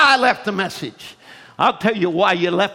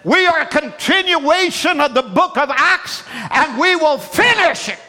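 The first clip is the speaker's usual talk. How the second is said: preaching; 9 semitones higher than usual. Acted. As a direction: preach it out to the crowd. Preaching at full force.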